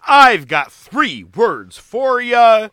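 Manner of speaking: booming ringmaster announcement, slowing down; big top announcer, building up. Acted. Ringmaster intro to movie.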